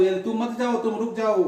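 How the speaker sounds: normal talking voice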